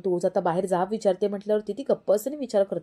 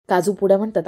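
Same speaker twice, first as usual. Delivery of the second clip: usual speaking voice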